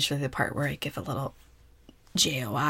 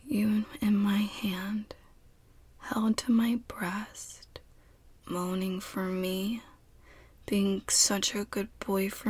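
tender nurturing whisper; 5 semitones higher, slower than usual. Acted. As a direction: murmur close and caring.

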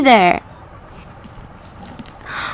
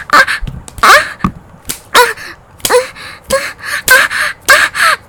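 high pained cries; 9 semitones higher than usual. Acted. Acted cries of pain.

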